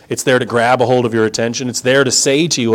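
usual speaking voice

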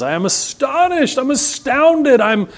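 high, slow, incredulous apostle; high slow outraged astonishment; astonished Paul. Retold or imitated. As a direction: quote Paul stunned at the Galatians. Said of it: "Paul back there to the Galatians".

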